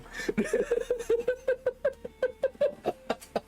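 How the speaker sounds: long high cackle of laughter; burst of laughter. Real, not acted. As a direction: laugh hard and high at the joke. Reacting to friend's joke.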